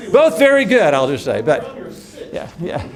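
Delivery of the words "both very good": high, loud, laughing aside; delighted laughing aside; amused burst. Real, not acted. Laughing banter over movie opinions.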